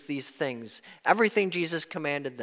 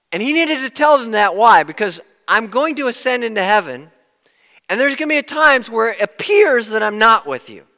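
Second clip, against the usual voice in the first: loud, higher preaching voice; fervent pulpit preaching; full-force preaching; 6 semitones higher, 10 dB louder than usual. Acted. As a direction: drive the point home to the congregation.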